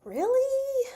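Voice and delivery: high squeaky disbelief; shocked really. Real, not acted. Reacting to the painkiller news, shocked and high. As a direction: shoot up in disbelief.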